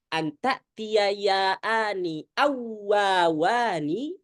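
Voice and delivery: awawani drawn out very slowly, each waw separate; clear recitation. Shown as a demonstration. Teacher reciting the rule text for students, slow and clear. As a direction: recite awawani slowly so both waw sounds are distinct. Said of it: "biar jelas".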